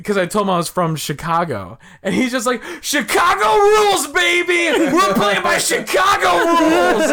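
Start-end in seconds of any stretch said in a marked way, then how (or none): 2.8-7.1 high, loud triumphant mock shout; imitated yelling. Opponent opening his arms wide, imitated yell. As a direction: bellow it high, stretching out the end. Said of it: "the other guy is yelling".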